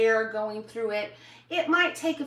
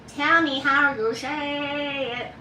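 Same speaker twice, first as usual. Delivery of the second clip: slow playful ask; 3 semitones higher, 4 dB louder, much slower than usual. Acted. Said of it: "asks viewers, drawn out".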